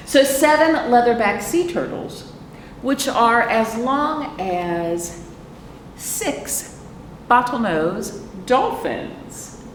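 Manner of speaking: slow, drawn-out picture-book read-aloud; slow storytime reading. A narrator's reading. Librarian reading the book to children, slow storytime read. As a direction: read each phrase slowly to the little ones.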